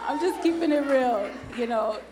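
amused, laughing admission. Real, not acted. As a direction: laugh while owning up to it. Laughing after her mock roar.

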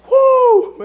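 high, drawn-out "woo"; sudden high preacher's holler; excited whoop. Real, not acted. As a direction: whoop high to rouse the congregation. Preacher whoops to rouse the congregation.

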